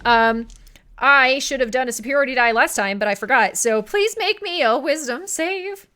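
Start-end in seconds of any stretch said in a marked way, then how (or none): 3.8-5.9 slow, higher, hesitant request; worried. Real, not acted. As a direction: ask nervously, dreading the answer. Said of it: "Why do you sound so concerned?".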